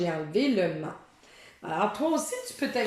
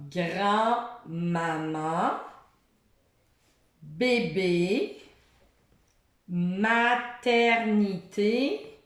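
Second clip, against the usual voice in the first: each word read very slowly and clearly; much slower than usual. Shown as a demonstration. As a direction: spell out each word for a child. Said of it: "Un autre jeu avec".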